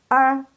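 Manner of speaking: R as "er", uh and r merged, high; model er. Shown as a demonstration. Teacher models the third sound, merged high er. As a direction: curl one held er for R. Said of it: "Itu disatuin".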